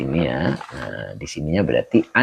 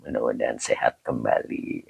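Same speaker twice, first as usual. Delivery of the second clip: soft prayerful whisper; 7 semitones lower, 8 dB quieter than usual. Real, not acted. Quiet prayer for a sick man.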